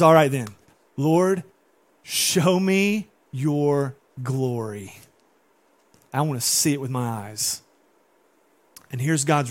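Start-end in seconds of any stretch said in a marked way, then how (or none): 0.0-7.6 drawn-out, hushed awe on show me your glory; slow each word as if asking to see glory; bold request. Retold or imitated. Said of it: "Moses goes".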